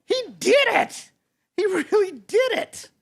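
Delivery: high, squealed celebration; jubilant cheer. Acted. Friends celebrate rooster's first crow, high jubilant squeal. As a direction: exclaim it high and thrilled.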